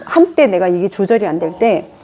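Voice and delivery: ordinary delivery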